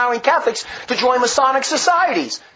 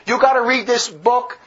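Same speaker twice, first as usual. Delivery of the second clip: ordinary talk, nothing marked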